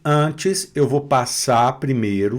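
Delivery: ordinary talk, nothing marked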